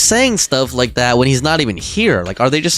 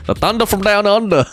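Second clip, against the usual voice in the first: playful bad Australian accent, higher pitch; terrible Aussie accent; 4 semitones higher than usual. Shown as a demonstration. Streamer showing chat a terrible Aussie accent. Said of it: "My terrible Aussie accent".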